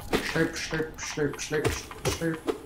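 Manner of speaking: slurp slurp chanted in a put-on voice; sing-song slurping noise; mock slurping. Gamer imitating the creature, slurping in a silly voice.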